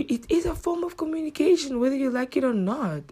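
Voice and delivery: high-pitched insistence; insistent. Real, not acted. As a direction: push the point upward in pitch. Insisting on her point.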